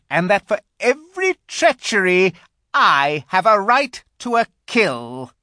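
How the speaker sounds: shrill, loud witch tirade; growing shriller. A narrator's reading. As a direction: declaim it high and loud in outrage. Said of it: "her voice growing suddenly shriller".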